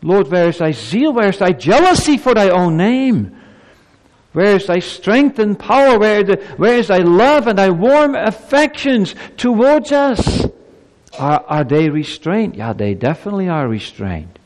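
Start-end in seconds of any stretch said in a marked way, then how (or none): 0.0-10.4 much higher and louder, rising to a cry; bold pleading prayer. Acted. Preacher voicing the prophet's bold prayer, loud pleading cry. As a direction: demand an answer from heaven. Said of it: "this is the prayer of".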